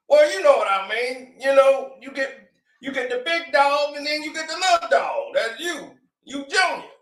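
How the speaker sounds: hammed-up father begging his famous son; pleading dad. Father begging [name] on the phone, high mock shout.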